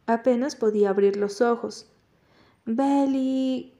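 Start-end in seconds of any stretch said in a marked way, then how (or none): 2.6-3.7 long, higher-pitched call of a name; understated drunken holler; drawn-out call. A narrator's reading. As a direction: call out her name, held on one note.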